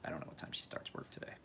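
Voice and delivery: real whispered aside while his wife is nearby. Real, not acted. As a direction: drop to a whisper so she won't hear. Aside about wife, off-mic.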